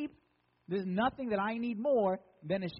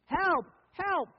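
high, loud cries for help; hammed-up high-pitched yell; mock screaming; 9 semitones higher, 6 dB louder than usual. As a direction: play a sheep yelling for rescue. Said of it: "the one sheep started screaming".

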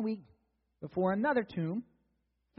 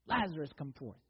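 usual speaking voice

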